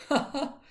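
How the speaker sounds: high, loud cackle; a greedy woman's cackle; cackling laugh. Acted. The charwoman cackles as Joe brings out the money, high and loud. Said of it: "laughed the same woman".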